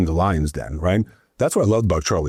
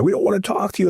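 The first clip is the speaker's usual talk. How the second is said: angry voter; 13 semitones higher, faster than usual. Retold or imitated. Angry couple refusing him.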